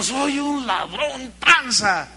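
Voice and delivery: lenta, voz cambiada, arrepentida; confesión de Zaqueo. Retold or imitated. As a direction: confiesa despacio, avergonzado. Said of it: "Y el Zaqueo". (Zaqueo confesando su pecado)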